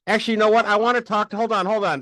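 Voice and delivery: high, loud put-on indignation; mock irate customer. Caller demanding the bot's manager, mock indignant. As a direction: pretend to cut in as a fed-up customer.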